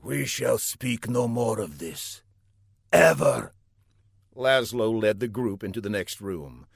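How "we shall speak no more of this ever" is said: lightly voiced villain, firm final word; controlled order, last word set apart; stern villain. A narrator's reading. Villain forbids the subject.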